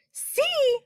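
very high-pitched outraged echo; high, incredulous outburst; indignant shout. A narrator's reading. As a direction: screech the word back in disbelief. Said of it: "shouted the poor earthworm".

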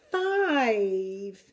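ordinary delivery, nothing marked

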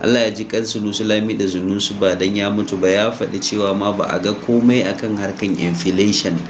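ordinary talk, nothing marked

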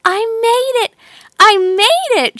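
high delighted exclamation; joyful. A narrator's reading. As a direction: burst out with joy. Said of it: "she thought".

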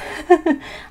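a short high embarrassed giggle. Real, not acted. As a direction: giggle at herself, a bit sheepish. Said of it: "I feel like I talk way too fast".

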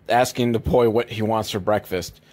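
usual speaking voice

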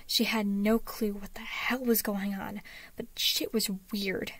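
ordinary delivery

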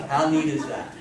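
ordinary talk

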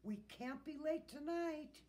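loud storybook urging; shouted. A narrator's reading. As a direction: keep shouting to the team. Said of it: "he shouted".

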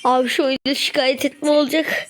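high-pitched frustrated rant; exasperated. Real, not acted. Gamer ranting at a teammate who stands around, exasperated. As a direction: complain in rising, heated disbelief. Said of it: "Sinirlenmeyeceğim".

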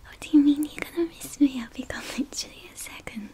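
ordinary delivery

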